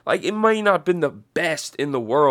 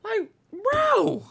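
much higher and louder, building to the end; outraged exclamation; 7 dB louder than usual. Real, not acted. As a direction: throw your hands up in disbelief. Outraged about part five.

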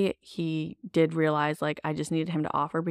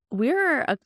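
ordinary talk

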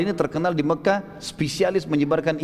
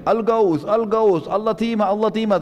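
higher-pitched mock alarm calls; imitated repeated shouting; 5 semitones higher than usual. Damdam shouts again before the Quraisy leaders, mock alarm. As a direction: chant the alarm like a retold crier. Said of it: "Teriak-teriak lagi".

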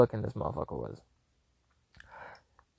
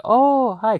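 surprised; 9 semitones higher, 5 dB louder than usual. Retold or imitated. Startled greeting retold.

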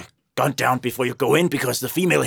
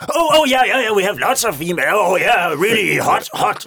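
loud, high, fast eager pitch; eager bluffing; 7 semitones higher, 5 dB louder than usual. Acted. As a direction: oversell the female hamsters. Hunter eagerly bluffs about hamsters.

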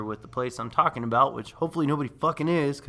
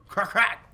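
vocal gunfire crack, a bit higher and louder; gunshot sounds; 7 semitones higher, 5 dB louder than usual. Retold or imitated. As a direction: spit out two quick shots. Imitating gunfire.

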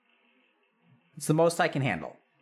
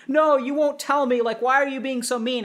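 high, louder whiny accusation, playing the wounded parent; pushy mother's whine, pitched up; hurt accusing parent; 8 semitones higher, 5 dB louder than usual.

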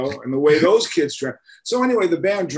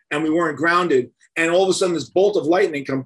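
usual speaking voice

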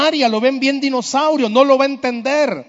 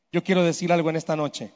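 usual speaking voice

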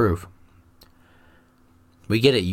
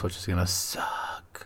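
muttered whisper; 4 semitones lower, 11 dB quieter, slower than usual. Real, not acted. Aside about the hot sauce.

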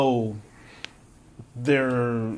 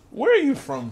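mock dramatic question; 12 semitones higher than usual. Host's high mock interview question.

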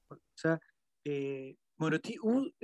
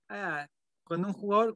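normal talking voice

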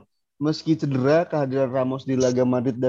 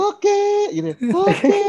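high head voice; falsetto; 4 dB louder, slower than usual. Shown as a demonstration. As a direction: flip into falsetto, much higher. Showing off falsetto after talk.

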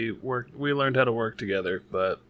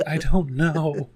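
loud, drawn-out mock despair, half laughing; exasperated kid; 4 semitones higher, 7 dB louder, slower than usual. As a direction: act out giving up on homework, higher and slower. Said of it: "drop my head on the table".

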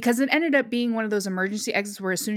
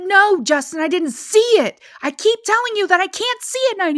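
higher, louder exasperated reply; frustrated, defending herself loudly; 11 semitones higher, 6 dB louder than usual. Retold or imitated. Retold argument in the car.

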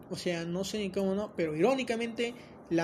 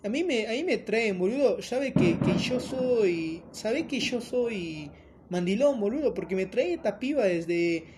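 put-on higher complaining Argentine accent with boludo; mock Argentine accent; 4 semitones higher than usual. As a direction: whine like a dragged-along Argentine buddy. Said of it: "che, Argentina". (acento argentino de burla, quejándose y más agudo)

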